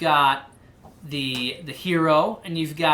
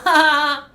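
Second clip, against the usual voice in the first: high, loud bully cackle; mocking laugh; 10 semitones higher, 9 dB louder than usual. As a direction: laugh at her meanly. Twins exploded in laughter.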